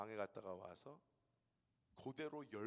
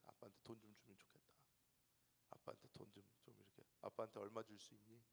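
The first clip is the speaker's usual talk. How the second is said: coaxing whisper; 3 semitones lower, 10 dB quieter, faster than usual. Retold or imitated. Dad coaxing kids for money.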